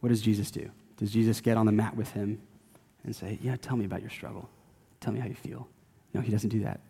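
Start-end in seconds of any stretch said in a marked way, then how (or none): 3.4-5.7 soft, hushed and indulgent; mock coddling sympathy. Preacher mimics a coddling Jesus, softly indulgent. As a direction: coo it like a pushover counselor.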